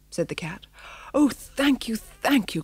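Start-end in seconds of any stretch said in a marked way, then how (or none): 1.1-2.6 higher grateful cry. A narrator's reading. Missus thanking the cat, a mild grateful cry. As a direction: gush with relief. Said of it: "cried Missus".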